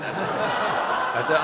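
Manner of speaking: real laugh while telling. Real, not acted. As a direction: laugh through the words at your own naivety. Laughs at his own naivety.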